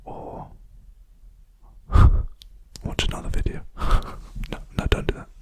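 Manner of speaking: unvoiced ASMR whisper. Real, not acted. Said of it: "I keep whispering, sorry, force of habit".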